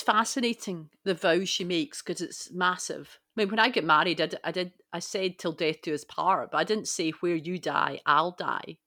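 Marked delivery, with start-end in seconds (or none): none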